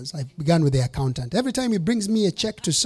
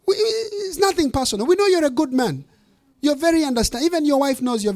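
high, sing-song praise; butter them up with a raised voice; mock flattery; 7 semitones higher than usual. Preacher mock-flatters congregation.

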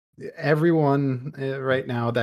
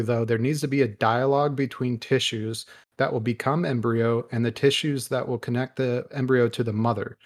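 ordinary talk, nothing marked

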